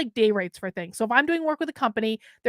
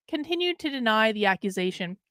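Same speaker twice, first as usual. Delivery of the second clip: ordinary talk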